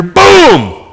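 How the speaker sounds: loud, high imitated blast; sudden booming shout, falling away; explosive boom. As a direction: boom it out like a tyre bursting. Imitates the tyre blowing out.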